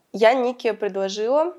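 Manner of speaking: ordinary talk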